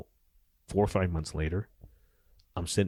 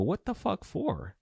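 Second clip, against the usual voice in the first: incredulous retort; 10 semitones higher, slower than usual. Retold or imitated. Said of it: "I go".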